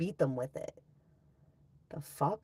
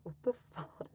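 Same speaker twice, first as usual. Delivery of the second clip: whispered disbelief; 6 dB quieter than usual. Retold or imitated. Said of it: "I was like".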